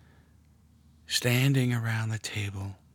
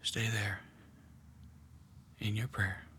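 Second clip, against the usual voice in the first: guided meditation; 5 dB quieter than usual. Acted. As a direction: draw them gently into the scene. Leads a guided prayer meditation.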